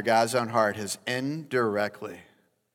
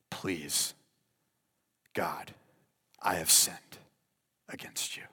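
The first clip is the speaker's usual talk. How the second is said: soft whispered plea; broken confession; 5 semitones lower, 6 dB quieter than usual. Acted. David repenting to God, soft whispered plea. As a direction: confess it, barely voiced. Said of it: "cut to the heart".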